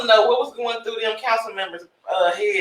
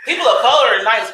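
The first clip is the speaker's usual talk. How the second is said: loud, laughing mock announcement; hammed-up loud voice; loud imitation; 8 dB louder than usual. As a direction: say it out loud and proud, mockingly. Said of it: "she'll say people of color all loud".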